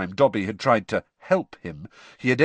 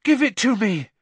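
desperate Harry; 10 semitones higher, 5 dB louder than usual. Acted. Harry struggling in the water.

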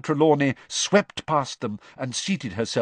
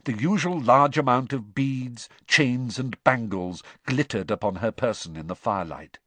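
usual speaking voice

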